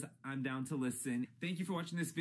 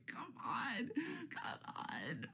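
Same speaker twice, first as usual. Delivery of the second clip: softer whimpering plea fading to a breath; whiny mock cry; 11 semitones higher, 6 dB quieter, much slower than usual. Real, not acted. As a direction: whimper it like you can't take any more. Pleading about undeserving players.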